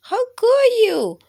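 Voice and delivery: very high, loud wail of betrayal; betrayed germ cry. Acted. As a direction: howl like a heartbroken little germ. Germs betrayed by Simon.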